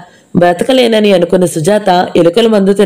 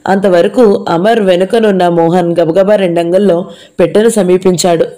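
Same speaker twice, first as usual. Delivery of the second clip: plain reading voice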